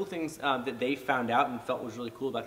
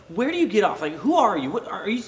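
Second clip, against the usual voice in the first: frustrated challenge; 7 semitones higher, 7 dB louder than usual. Retold or imitated. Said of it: "it's frustration".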